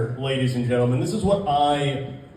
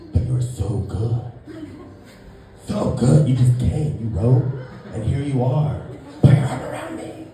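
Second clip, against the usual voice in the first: lower seductive imitation; 4 semitones lower than usual. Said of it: "And she says".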